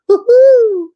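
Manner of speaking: very high, loud "woohoo"; high whoop. Retold or imitated. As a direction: whoop it out, long and triumphant. Retelling just doing the accent.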